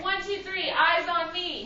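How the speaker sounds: sing-song, high, loud attention call; kindergarten teacher voice. Real, not acted. Class attention call repeated.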